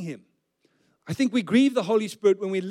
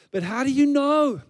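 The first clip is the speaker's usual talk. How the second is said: high, louder sceptical question; insistent questioning; 8 semitones higher, 5 dB louder than usual. Acted. Preacher voicing the listener's private doubts, insistent questioning. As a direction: push the question up, exasperated.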